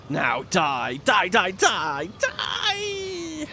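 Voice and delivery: villain shriek. Acted. Villain cries out in battle.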